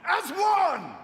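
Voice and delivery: high, loud crowd hype; hype shout. Real, not acted. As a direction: whip the crowd up. Hyping up the crowd on stage.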